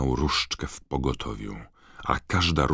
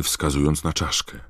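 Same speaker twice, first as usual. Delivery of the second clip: plain reading voice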